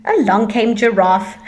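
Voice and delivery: ordinary delivery, nothing marked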